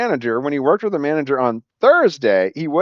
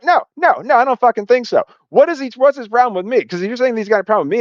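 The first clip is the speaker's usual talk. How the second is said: indignant outburst; 9 semitones higher than usual. Retold or imitated. Retelling his heated refusal to the general manager, high indignant outburst. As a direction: push back hard, voice rising.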